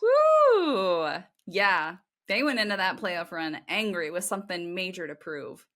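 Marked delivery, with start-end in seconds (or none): 0.0-1.1 loud, high whoop of delight; excited cheer. Real, not acted. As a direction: let out a joyful whoop. Cheering a surprising stat.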